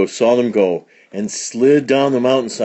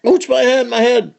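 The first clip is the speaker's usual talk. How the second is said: high, loud comic wail; wailing in pain; 13 semitones higher, 4 dB louder than usual. Acted. Outlaw hits the stagecoach roof, high pained wail. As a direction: wail it like a storybook crybaby. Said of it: "wailed Butch Jones".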